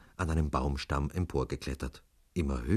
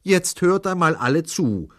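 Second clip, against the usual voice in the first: high, loud call to attention; raised, insistent voice; stationmaster taking charge; 14 semitones higher, 10 dB louder than usual. A narrator's reading. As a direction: demand the whole crowd's attention. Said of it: "sagte da der kleine Stationsvorsteher".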